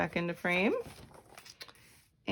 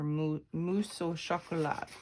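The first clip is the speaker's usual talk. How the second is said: mousse au chocolat in a careful French accent; clumsy French accent on the dish name; French attempt. Shown as a demonstration. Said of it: "my French accent is horrible".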